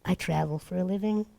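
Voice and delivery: quiet, hushed mock-serious line. Telling the girl secretly, hushed and mock serious. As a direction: say it low and deadpan, fighting a laugh. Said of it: "play really serious".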